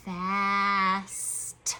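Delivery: FAST low, with vowel and s held long; sounding out slowly. Shown as a demonstration. Teacher works toward the last letter, word stretched. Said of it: "gonna say it slow again".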